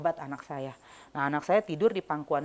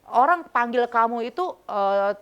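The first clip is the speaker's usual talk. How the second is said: challenging, like a thug; 5 semitones higher, 7 dB louder than usual. Retold or imitated. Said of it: "kayak sama preman gitu".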